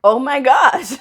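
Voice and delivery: high, loud, laughing disbelief; mock exasperation. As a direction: exclaim at the absurd fuss. Acted reaction to excited lady.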